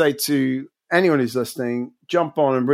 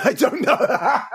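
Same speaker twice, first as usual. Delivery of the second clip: laughing; 10 semitones higher, slower than usual. Real, not acted. Teasing echo of guest.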